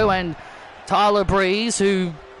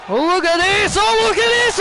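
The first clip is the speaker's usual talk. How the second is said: building excitement; 10 semitones higher, faster than usual. Acted. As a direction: climb from calm to thrilled. Repeated commentator hype call.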